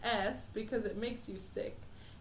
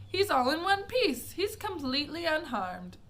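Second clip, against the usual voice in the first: higher, brighter relieved cry; delighted; 6 semitones higher, 5 dB louder than usual. A narrator's reading. Wonka gladly assuring the parents. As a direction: announce the good news gladly. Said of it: "cried Mister Wonka".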